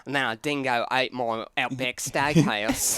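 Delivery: put-on Australian with upward inflections; Australian accent. Shown as a demonstration. Host demonstrating on request to friends, Australian accent. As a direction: explain it in a mock Australian TED-talk accent. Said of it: "How's your Australian accent?".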